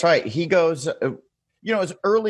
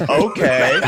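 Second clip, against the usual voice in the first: okay breaking into a loud laugh; 4 dB louder than usual. Real, not acted. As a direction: laugh it out, half shouting. Laughs at the shark instructions.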